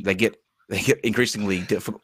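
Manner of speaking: ordinary talk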